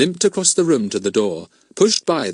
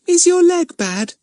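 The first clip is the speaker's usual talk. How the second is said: put-on slow, much higher girl's voice, full of sympathy; sympathetic; 6 dB louder, slower than usual. A narrator's reading. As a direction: ask gently like a worried little girl. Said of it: "she asked sympathetically".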